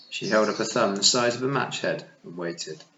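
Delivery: ordinary delivery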